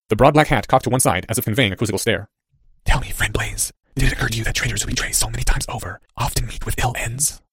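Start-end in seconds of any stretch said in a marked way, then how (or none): 2.9-7.4 soft, sinister whisper; unidentifiable whisper. A narrator's reading. Cloaked figure warns the young traitor, whispered. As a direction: whisper a veiled threat. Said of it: "said the unidentifiable whisper".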